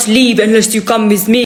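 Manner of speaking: manic, cringe fake Arabic accent; hammed-up fake Arabic accent, pushy; impression of fake Arabic accent. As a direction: mimic her pushy fake accent, rising at the end. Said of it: "my impression just then".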